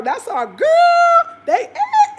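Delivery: put-on high, loud, very slow "girl"; cheering sister. Imitating sisters proudly cheering her on. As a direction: stretch it out in delight.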